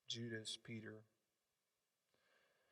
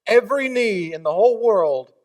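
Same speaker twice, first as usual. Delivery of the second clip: preaching at full force; 6 semitones higher, 11 dB louder than usual. Acted. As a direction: proclaim it to the whole room. Preacher's loud closing declaration.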